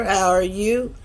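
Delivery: normal talking voice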